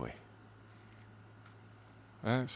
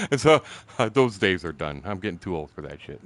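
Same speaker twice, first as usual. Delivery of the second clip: ordinary talk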